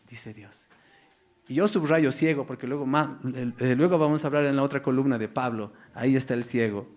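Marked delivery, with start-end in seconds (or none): none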